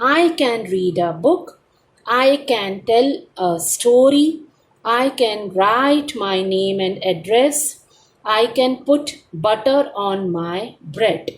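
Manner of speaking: teacher reads slowly so the class can repeat after her; slow dictation reading. Shown as a demonstration. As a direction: read each sentence slowly and evenly. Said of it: "repeat after your teacher". (धीमे, साफ़ आवाज़ में दोहराने के लिए पढ़ना)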